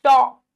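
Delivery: चौ alone, loud and wide; loud single syllable चौ; open औ vowel. Shown as a demonstration. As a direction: isolate चौ with an open mouth. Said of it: "औ की मात्रा".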